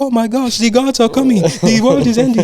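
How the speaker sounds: frantic mock terror; mock panicked screaming. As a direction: squeal like scared kids as the sky darkens. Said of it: "everyone just started screaming".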